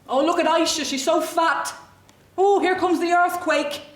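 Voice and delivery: jeering schoolmates. Acted. Schoolmates taunt a fat girl at school, loud mocking. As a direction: call it out loudly, like a bully jeering. Mocking bullies quoted in a monologue.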